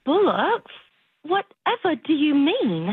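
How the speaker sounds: slow, puzzled put-on British; fake British accent. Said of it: "drop the British thing".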